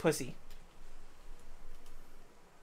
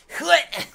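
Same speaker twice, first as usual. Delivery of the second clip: sudden high, loud "hwut!"; fly push-up noise; 9 semitones higher, 9 dB louder than usual. Retold or imitated. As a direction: imitate a fly springing into take-off. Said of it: "They go".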